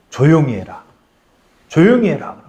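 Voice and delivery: terse order after a long pause; firm command, spaced and repeated; commanding Jesus. Retold or imitated. Jesus silences the confessing demon, firm command. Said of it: "예수님이 뭐라고 그랬어요".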